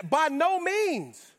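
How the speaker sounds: high, slow, drawn-out disbelieving no; incredulous refusal. Retold or imitated. Paul answers the Romans, high slow incredulous refusal. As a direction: stretch it out in comic disbelief. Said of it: "He gives him the Cat Williams face".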